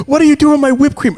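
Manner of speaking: high, loud mock-furious demand; shrieky-pitched outrage, played for laughs; retold arguing. Parent confronts son over whipped cream, arguing. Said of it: "I'm arguing with him".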